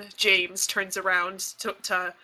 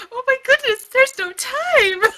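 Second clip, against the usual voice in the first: frantic laughing plea; 7 dB louder than usual. Acted. Galena begs the clerk in frantic laughing panic. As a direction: panic through giggles. Rolled intimidation, replays plea.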